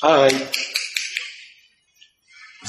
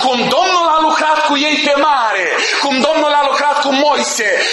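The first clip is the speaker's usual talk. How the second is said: fervent preaching; 5 semitones higher than usual. Acted. Preacher proclaiming to the congregation that the Lord never changes, fervent. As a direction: preach it out to the whole church.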